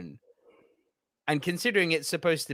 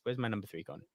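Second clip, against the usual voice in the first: ordinary talk, nothing marked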